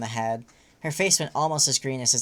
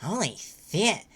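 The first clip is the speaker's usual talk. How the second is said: surprised curse; 5 semitones higher than usual. Acted. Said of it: "said Solix".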